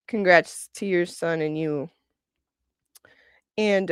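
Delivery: normal talking voice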